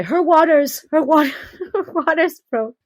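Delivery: put-on husband's Spanish accent, urgent, breaking into a laugh. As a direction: imitate her husband's Spanish accent in a rush. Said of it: "in his little Spanish way".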